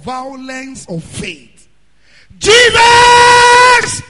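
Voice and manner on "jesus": long desperate shout, much higher and louder; crying out. Retold or imitated. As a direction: call out to him with everything. Blind man crying out.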